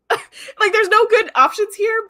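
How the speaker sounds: high, loud mock-anguished lament; sigh, then wail it in mock despair; acted exasperation. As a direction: replay your dismay, hammed-up and high-pitched. Acts out own reaction to episode.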